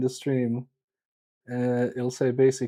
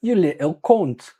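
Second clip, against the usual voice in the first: attempted Australian accent, higher and louder; 4 semitones higher, 5 dB louder than usual. Streamer teases a viewer in mock Australian accent. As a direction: throw out a jokey Australian insult. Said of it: "That was my Australian".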